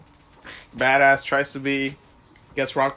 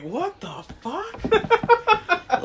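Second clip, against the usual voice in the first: much higher, very slow, laughing; drawn-out disbelief; much slower than usual. Retold or imitated. Fan recalls his shock at the ending, drawn out. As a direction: drag it out laughing. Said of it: "I was like".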